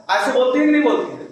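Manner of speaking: ordinary talk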